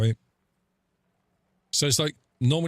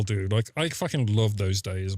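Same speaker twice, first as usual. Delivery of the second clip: usual speaking voice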